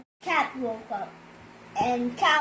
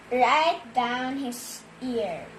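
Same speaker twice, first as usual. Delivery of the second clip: usual speaking voice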